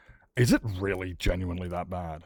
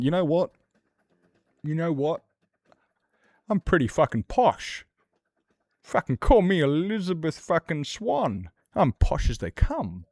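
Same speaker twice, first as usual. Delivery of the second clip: higher, put-on plummy toff voice; mock posh; 7 semitones higher than usual. Reacting to the ferals on TV, a lightly hammed-up posh voice. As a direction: play a lightly hammed-up aristocrat.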